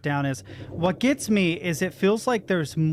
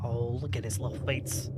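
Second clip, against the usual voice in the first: overwhelmed by cuteness; 5 semitones lower, 7 dB quieter than usual. Real, not acted. Cooing over cute creature.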